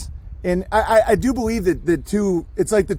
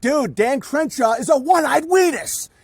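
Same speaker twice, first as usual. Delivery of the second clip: exasperated retort; 7 semitones higher than usual. Retold or imitated. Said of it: "and I'm like".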